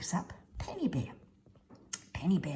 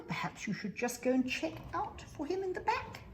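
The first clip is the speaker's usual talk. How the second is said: parent voice; 11 semitones higher, 3 dB quieter than usual. Acted. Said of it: "Mummy and Daddy say".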